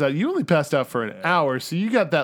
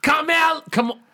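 mock mobster yell; 9 semitones higher than usual. Sopranos yell echo.

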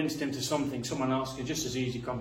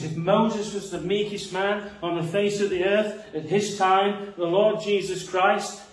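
raised, louder preaching voice climbing in passion; fervent preaching; 4 semitones higher, 4 dB louder than usual. Acted. Pastor exalting Christ's meekness to the congregation, fervent. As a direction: preach it out with rising fervor.

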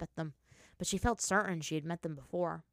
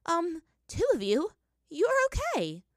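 surprised; 11 semitones higher, 7 dB louder than usual. A narrator's reading. Relieved at seeing Toph.

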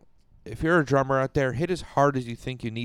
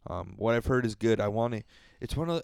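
ordinary talk

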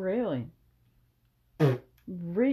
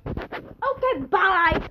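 Mowgli voice; 9 semitones higher, 6 dB louder, much slower than usual. Acted. Mowgli voiced, dismissive goodbye.

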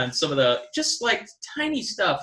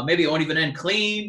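ordinary talk

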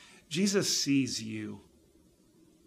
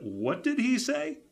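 stunned disbelief; 11 semitones higher than usual. Retold or imitated. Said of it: "there were a few people that went".

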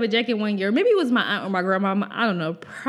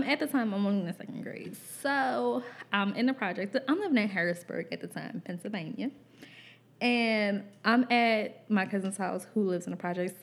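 ordinary delivery

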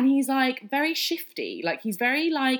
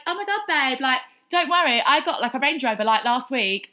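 put-on high, louder, quick gushy girl voice; gushing girls; 5 semitones higher, 5 dB louder than usual. Colleagues in the changing room reassuring her, high and gushing. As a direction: play the posh friend brushing it off.

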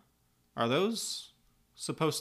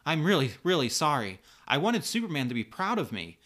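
higher, louder, pleading apology; apologizing profusely; 5 semitones higher, 5 dB louder than usual. A narrator's reading. Kara to Linda after hurting her, distraught apology. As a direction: apologize in an upset, earnest rush. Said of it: "Kara continues to apologize".

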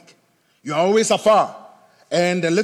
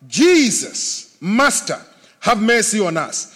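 loud, raised plea, drawn-out name; calling out loudly; 5 dB louder, slower than usual. Acted. Lepers calling to Jesus from a distance, loud plea. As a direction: call out for mercy. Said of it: "called out with a loud voice".

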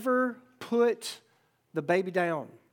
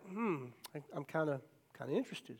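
low, soft, hesitant musing; tentative interest; 5 semitones lower, 10 dB quieter than usual. Acted. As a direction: muse quietly, not quite admitting it. Shy boy eyeing a girl.

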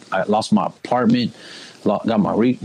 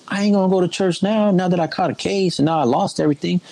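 defiant refusal, quoted; 7 semitones higher than usual. Retold or imitated. Quoting his refusal to parents.

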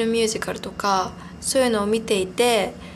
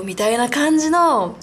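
ordinary talk

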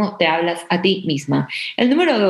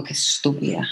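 muttered under the breath, low and soft; self-scolding mutter; 4 semitones lower, 5 dB quieter than usual. Retold or imitated. Muttering at herself after a mistake, hushed and low. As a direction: scold yourself quietly after a slip. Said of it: "a veces como que hago algo y digo".

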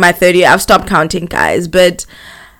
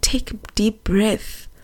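whispered, soothing retelling of gran; calm them in a near whisper; very soft and breath-filled; hushed comfort; 2 semitones higher, 9 dB quieter than usual. Retold or imitated. Gran calming her, retold near tears.

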